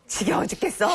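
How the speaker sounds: bored sigh of a fed-up mom, laughing; mock weary complaint. Mom grumbling to herself, fed up and laughing. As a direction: groan it out like you're sick of it all. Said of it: "어떤 말 써요?".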